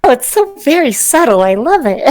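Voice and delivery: enthusiastic character gushing approval. Acted. Celine approving of the sign, enthusiastic. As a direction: gush over it with delight. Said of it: "Celine says enthusiastically".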